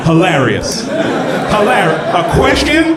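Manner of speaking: high, drawn-out laughing disbelief; mock incredulous. Comic mocking a questionnaire's odd wording. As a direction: act baffled that a form is funny. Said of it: "a questionnaire being like".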